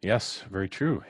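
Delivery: ordinary talk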